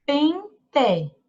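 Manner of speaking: PEN, a pause, then TE; syllable by syllable. Shown as a demonstration. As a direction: split PEN-TE into two separate syllables. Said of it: "vamos chamar ela pra ver".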